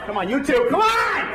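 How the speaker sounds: high loud urging shout. Pretend. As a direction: yell at the stragglers to move. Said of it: "I'm like".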